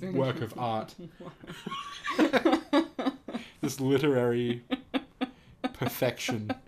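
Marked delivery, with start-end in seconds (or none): 1.0-3.6 high burst of laughter that falls away; big laugh. Real, not acted. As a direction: crack up at the line. Reading absurd fanfic aloud.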